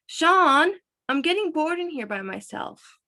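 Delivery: high-pitched call, then complaining; bored complaint. A narrator's reading. Bored girl calls for Sean.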